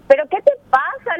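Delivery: half-yelled "¿Pero qué te pasa?" in a retold argument; mock indignant yell. Retold confrontation with police officer.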